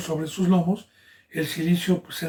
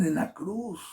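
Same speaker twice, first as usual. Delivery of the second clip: ordinary delivery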